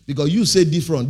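ordinary talk

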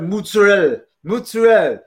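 "Mozzarella" cut short to mutzarell, hammed up; Italian-American pronunciation. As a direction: mimic a New Yorker saying "Mozzarella" as mutzarell. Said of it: "he used to call, he said".

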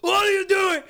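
high, loud mock yell; referee shouting. Referee yelling at the puking player, loud and high. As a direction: yell it like an angry ref.

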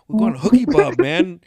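eager announcement. Retold or imitated. Johnny to the driver, retold.

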